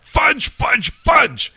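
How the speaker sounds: high, loud comic outburst; screamed fudge. Acted. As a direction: scream it like a golfer's substitute curse. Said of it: "Sorry for screaming in your ear".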